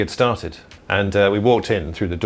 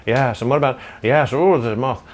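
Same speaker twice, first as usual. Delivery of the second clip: Eddie Izzard impression; 6 semitones higher, 4 dB louder, slower than usual. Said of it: "when Eddie Izzard does it, he just goes".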